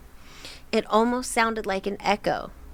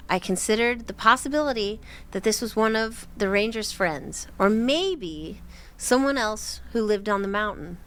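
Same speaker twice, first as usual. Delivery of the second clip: ordinary talk, nothing marked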